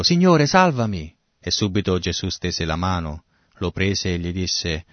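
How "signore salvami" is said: high, urgent plea read aloud; grido d'aiuto. A narrator's reading. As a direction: cry out for rescue, pitched higher. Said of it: "gridò dicendo". (grido acuto di aiuto)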